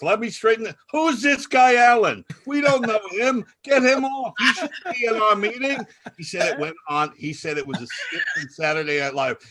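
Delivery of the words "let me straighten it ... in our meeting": high, loud mock outrage; indignant shouting. Party guest demanding a stranger leave the meeting, indignant shouting. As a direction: imitate an irate old man butting in. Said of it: "this other guy comes in and he goes".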